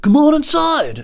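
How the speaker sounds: high, loud, drawn-out mimicry; put-on stranger's call. Stranger beckoning driver into lonely house, high drawn-out mock call.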